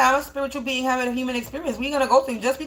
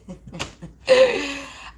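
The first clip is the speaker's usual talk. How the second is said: amused laugh. Real, not acted. Speaker amused at calling herself that, giggling laugh. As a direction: laugh at your own bold claim. Laughs after own boast.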